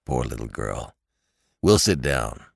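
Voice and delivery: a relieved father comforts his crying daughter, low and tender. A narrator's reading. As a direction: soothe her gently, low. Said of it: "his relief made him very tender".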